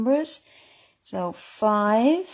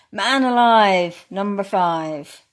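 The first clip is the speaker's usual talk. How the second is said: slow, drawn-out bingo call; bingo caller; 6 dB louder, slower than usual. Acted. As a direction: call it out slowly for the room. Calling bingo numbers.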